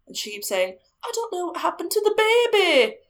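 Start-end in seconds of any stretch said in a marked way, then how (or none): 1.0-3.0 high, strained imitation of a sobbing woman; imitating crying. Grieving ghost mother in a retold séance, high and tearful. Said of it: "She's crying".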